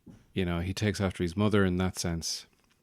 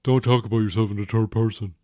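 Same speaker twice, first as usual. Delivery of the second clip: quick, louder put-on teenage voice; teen son telling dad off, quickly; fast sulky teen; 5 dB louder, faster than usual. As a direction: snap back like an annoyed teen. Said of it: "don't mock your father".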